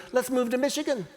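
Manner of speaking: lightly voiced son, simple and sure; little kid's idea. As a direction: pitch it up like a kid with a bright plan. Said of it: "he goes".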